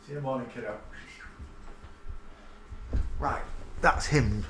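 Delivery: normal talking voice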